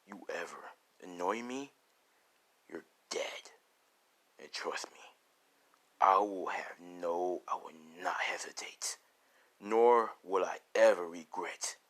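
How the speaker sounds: measured warning, close to a whisper; ruthless threat. Acted. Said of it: "how ruthless Deku sounds".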